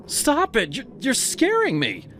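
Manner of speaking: terrified cry up; panicked plea; scared pleading. Acted. Ava terrified below.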